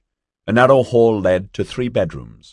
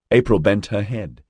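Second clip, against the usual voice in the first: ordinary delivery, nothing marked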